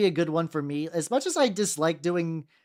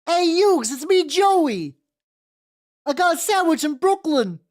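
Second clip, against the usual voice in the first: put-on loud, high, drawn-out Brooklyn accent; a hammed-up Brooklyn guy hollering down the street; 10 semitones higher, 7 dB louder than usual. Streamer showing off a goofy Brooklyn accent, loud and high. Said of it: "I do like doing the".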